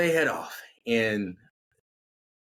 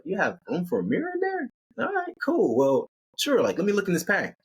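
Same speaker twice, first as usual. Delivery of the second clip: incredulous; 9 semitones higher than usual. Acted. Chris incredulous about mirror.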